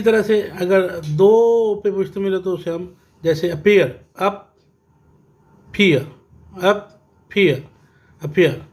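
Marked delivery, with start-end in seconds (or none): 4.2-6.0 appear split in two, अ, then peer, with a long pause; appear as two separate beats; syllable by syllable. Shown as a demonstration. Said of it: "ये टू syllable था". 6.6-7.6 appear in two short beats, अ, then peer; syllable by syllable. Shown as a demonstration. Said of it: "ये टू syllable था".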